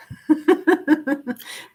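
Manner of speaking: real laugh, a bit uneasy; awkward laugh. Real, not acted. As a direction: laugh it off before going on. Laughs about her sister.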